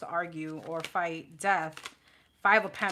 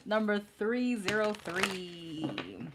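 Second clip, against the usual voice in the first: slow drawn-out call; much slower than usual. Real, not acted. Reader slowly calling the client's number, drawn out. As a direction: stretch each number out slowly.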